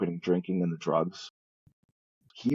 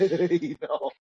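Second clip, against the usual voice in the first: he knows, said through a real laugh; 9 semitones higher than usual. Real, not acted. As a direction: laugh out the words as you repeat the joke. Laughing repeat of the joke.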